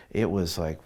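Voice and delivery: ordinary talk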